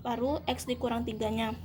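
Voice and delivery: normal talking voice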